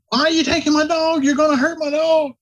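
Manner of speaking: high-pitched hollering, imitating a frantic client; mock screaming. Client hollering after staff carrying off the dog, high mock scream. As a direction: holler it high, mimicking an outraged dog owner. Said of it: "He's screaming, hollering".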